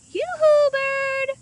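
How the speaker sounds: high, loud sing-song yoo-hoo; hooted call. A narrator's reading. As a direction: call up to the bird like a hoot. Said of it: "hooted Kendall".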